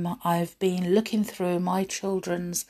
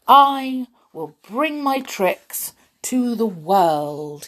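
slow, raised, grand proclamation; determined hero's announcement, higher voice; bold declaration; 4 semitones higher, 7 dB louder, slower than usual. A narrator's reading. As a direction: proclaim it to the world, stretching the words. Dog declares his grand plan.

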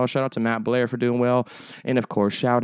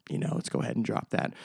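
breathy whisper, much softer; whispered repeat of the complaint; whispered aside; 8 dB quieter than usual. Pretend. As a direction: mutter it to yourself under your breath.

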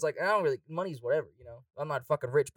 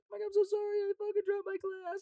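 high, squeaky mock apology; apologetic drunk girl; 4 dB quieter than usual. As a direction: play the guilty drunk girl saying sorry. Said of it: "She's like".

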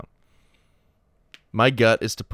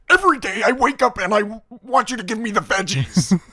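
pitched way up and loud; high silly voice; 13 semitones higher, 6 dB louder than usual. Pretend. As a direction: squeal the catchphrase up high. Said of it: "write that as a catchphrase".

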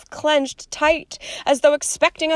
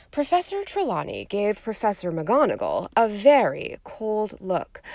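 ordinary delivery

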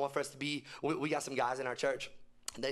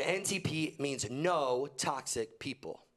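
slow explanation; slower than usual. Shown as a demonstration. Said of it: "spelling out the acronym".

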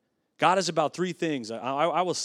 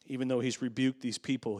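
ordinary delivery

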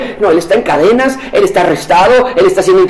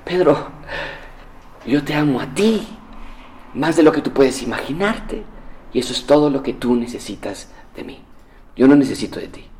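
tender Jesus; 4 semitones lower, 9 dB quieter than usual. Acted. Jesus reassuring anxious Peter, soft and tender. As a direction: speak it gently, like a reassurance.

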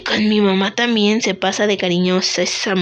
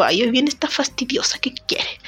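whispered, much softer, fed-up grumble; mock annoyed whisper; 5 dB quieter than usual. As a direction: whisper it as if someone pesky is walking up. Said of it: "yo digo como que". (susurro fastidiado de burla)

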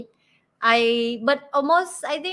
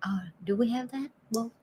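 hushed whispered question; whispering; 8 dB quieter than usual. Real, not acted. Asks in hushed voice.

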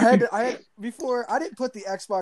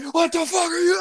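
angry yell; 12 semitones higher, 3 dB louder than usual. Retold or imitated. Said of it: "I was like eight years old walking to a party".

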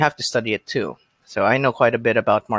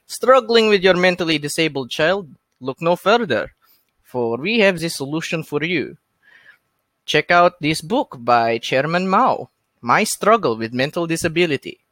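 higher-pitched, hammy Russian infomercial pitch; Russian-accented advertisement; 4 semitones higher than usual. Acted. Podcaster ad-libs a book advertisement, cheesy Russian accent. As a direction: sell it like a cheesy Russian announcer. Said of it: "ad-lib an advertisement for this book".

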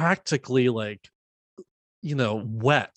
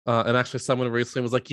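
normal talking voice

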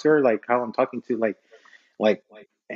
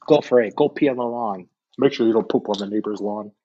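ordinary talk

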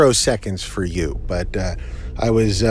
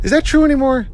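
surprised; 13 semitones higher, 4 dB louder, faster than usual. Real, not acted. Questions his own joke.

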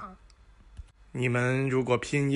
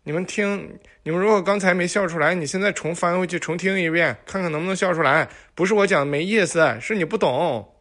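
fast, loud mock scolding; fast teasing rant; 4 semitones higher, 5 dB louder, faster than usual. Real, not acted. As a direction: rattle it off to tease them.

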